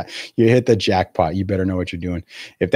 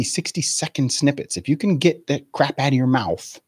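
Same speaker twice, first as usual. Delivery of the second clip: normal talking voice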